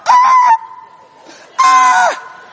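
piercing high mock shrieks; two loud put-on screams; imitated hollering. Preacher imitates wife who kept hollering, high shrieks. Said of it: "Kathy kept hollering".

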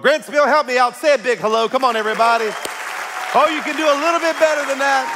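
loud, playful crowd hype; rallying the crowd. Real, not acted. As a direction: rev up the congregation to shout hello. Pastor urging crowd to cheer.

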